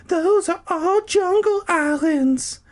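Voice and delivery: put-on very high, slow girly voice; high woman voice. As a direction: play Carolyn high and sweet. Carolyn's high voice.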